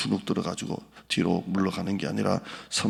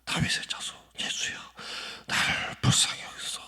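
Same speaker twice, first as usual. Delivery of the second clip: strained whispered cry for mercy; a whispered plea that sounds like a sob; desperate cry; 4 dB quieter than usual. Retold or imitated. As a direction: cry out in a hushed, breaking voice. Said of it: "다짜고짜 소리를 지릅니다".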